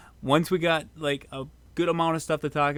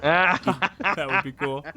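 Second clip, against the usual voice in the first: laughing while speaking; 2 semitones higher, 5 dB louder, much slower than usual. Real, not acted. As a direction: say it through a laugh. Laughing at fan comment.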